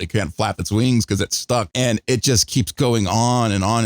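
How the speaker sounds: ordinary delivery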